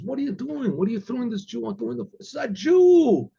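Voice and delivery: high-pitched acted disbelief; incredulous challenge. Old Cossack questioning the window plan, incredulous. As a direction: question them in mock astonishment, rising on the last words.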